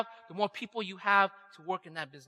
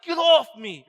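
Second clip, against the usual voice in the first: loud, higher, furious push-off; mock angry yell to a rival; roaring irritation; 5 semitones higher, 9 dB louder, slower than usual. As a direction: shove the words at her in a rage. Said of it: "It was like a roaring irritation".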